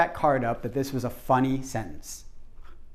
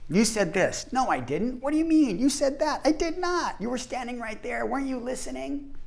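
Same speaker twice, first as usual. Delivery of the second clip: high-pitched acted squabble; playful imitation of a heated argument; mock bickering; 8 semitones higher than usual. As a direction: argue back and forth, indignant and pitched up. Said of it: "And then they start fighting".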